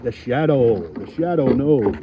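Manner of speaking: low, loud, ominous announcer; hammed-up mysterious proclamation; ominous catchphrase. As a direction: boom it out low, drawing out the end. Hammed-up catchphrase about his shadow.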